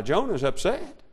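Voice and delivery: ordinary delivery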